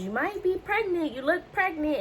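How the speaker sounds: high, sing-song teasing; joking nurse. Retold or imitated. Nurse trying to joke with worried patient, high-pitched teasing. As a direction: imitate a nurse making light of it. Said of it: "she tries to joke about it".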